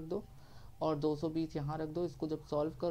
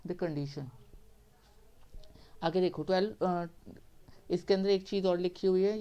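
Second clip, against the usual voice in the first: ordinary talk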